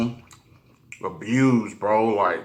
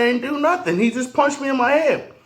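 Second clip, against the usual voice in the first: put-on child self protesting; 12 semitones higher than usual. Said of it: "I said".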